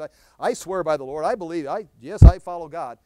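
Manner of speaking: mock earnest pledge; insistent false believer. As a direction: overprotest your faith.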